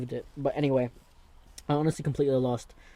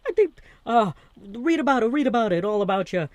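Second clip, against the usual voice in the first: high loud mock newsboy shouting the headline; newsboy cry; 9 semitones higher, 7 dB louder than usual. Impersonating a newsboy to viewers, high loud street cry. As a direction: holler like an old-time newspaper boy.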